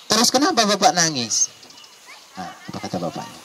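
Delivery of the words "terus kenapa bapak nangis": high-pitched question from the son; acted perplexed son; puzzled question. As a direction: ask father high, falling away at the end.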